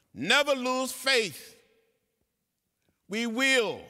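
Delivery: loud, high, ringing sermon climax; preaching at full force. Real, not acted. Elder closing his speech to young people, preaching loudly. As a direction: lift the room, never give up.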